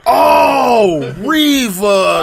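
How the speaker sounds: high, loud drawn oh of realization; light up as it finally clicks; mock shout of recognition; sudden realization. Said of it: "friend realizing the name".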